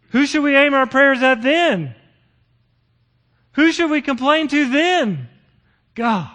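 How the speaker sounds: loud, high rhetorical questions; preacher challenging the congregation, loud and fervent; fervent preaching. Real, not acted. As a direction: drive the questions home to the congregation. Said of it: "preaching at full force".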